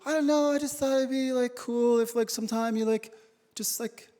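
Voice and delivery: hesitant mock girlfriend voice; wife's wistful wish. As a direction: play the wife trailing off wistfully. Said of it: "She goes".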